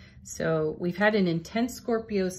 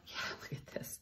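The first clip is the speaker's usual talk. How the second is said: whispered softly in awe; hushed aside; 15 dB quieter, faster than usual. Real, not acted. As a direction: breathe it out in a whisper over the card. Tarot card reveal, hushed aside.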